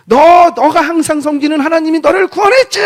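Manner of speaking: high, loud shouted question; king's shout. Acted. Preacher as King Darius calling to Daniel in the den, shouting. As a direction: cry it out urgently across a distance. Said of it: "소리를 지르면서 했대요".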